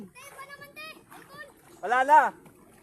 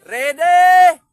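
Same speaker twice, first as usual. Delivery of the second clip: loud call to start the race; 3 semitones higher, 16 dB louder than usual. Real, not acted. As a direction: shout it across the yard.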